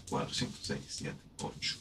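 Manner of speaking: counting syllables under the breath; soft counting whisper. Shown as a demonstration. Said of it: "counting syllables".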